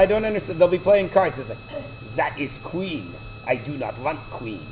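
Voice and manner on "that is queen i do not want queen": softly mimicked Russian accent. Dad imitating his daughter at cards, mock Russian accent. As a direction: imitate kids playing at a thick Russian accent. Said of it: "speak to each other in Russian accents".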